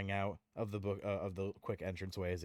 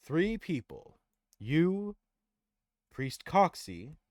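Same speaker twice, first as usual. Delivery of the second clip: Tyrael offering; 4 semitones higher, 5 dB louder, much slower than usual. Acted. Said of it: "And Tyrael says".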